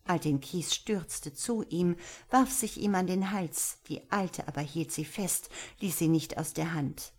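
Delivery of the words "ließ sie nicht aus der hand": ordinary delivery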